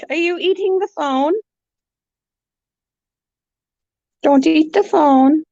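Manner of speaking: very high sing-song hellos; squeaky pet greeting. Real, not acted. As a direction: coo hello at the parrot. Greeting bird at cage.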